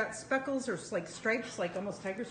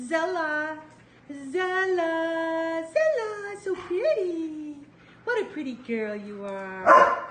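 high, loud sing-song cooing; 8 semitones higher, 8 dB louder, slower than usual. Real, not acted. Owner cooing to the new puppy in high sing-song.

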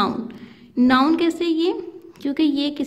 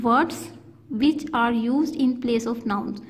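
slow dictation; much slower than usual. Shown as a demonstration. Teacher dictating definition slowly.

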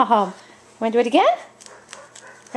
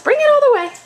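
high, louder coaxing call; high dog-talk voice; 10 semitones higher, 4 dB louder, much faster than usual. Real, not acted. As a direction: coax the dog all the way in. Calling dog to bring toy.

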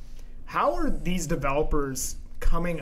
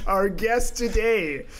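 drawn-out announcer's guest introduction; podcast voice; 3 semitones higher than usual. Pretend. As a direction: punch each title like a show host. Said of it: "Why are you talking like that".

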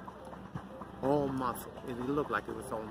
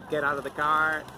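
louder barked command; mock police order; 3 semitones higher, 8 dB louder than usual. Cop ordering the driver out, louder. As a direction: bark it like a traffic cop. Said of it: "and out of".

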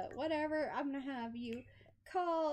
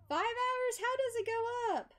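high, loud incredulous outburst; real disbelief; 11 semitones higher, 6 dB louder than usual. Real, not acted. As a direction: protest it in a high, raised voice. Reacts to game timer going up.

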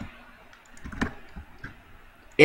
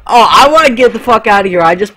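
panicked; 7 semitones higher, 8 dB louder, faster than usual. Real, not acted. Panicked escape in game.

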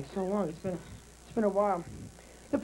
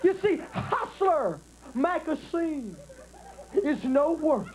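Jimmy Swaggart sermon. Retold or imitated. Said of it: "Jimmy got his legs spread wide open".

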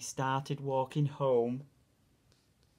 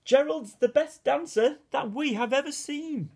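very high, loud astonished shouting; amazed shout; 13 semitones higher, 5 dB louder than usual. A narrator's reading. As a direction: cheer out in disbelief at his dancing. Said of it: "They shouted".

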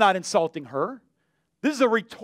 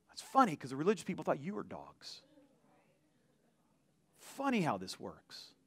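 ordinary talk

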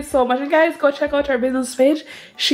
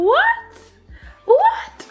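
very high, delighted laughing squeal; surprised squeal. Real, not acted. Mom unboxing a friend's gift, squealing with delight. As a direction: shriek in disbelief at another surprise. Spots more surprises in package.

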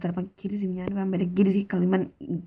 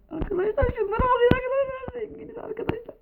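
much higher, giddy squeal over the loot; excited squeal. Real, not acted. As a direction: squeal like you just got rich. Showing off found riches. (heyecanlı ince çığlık)

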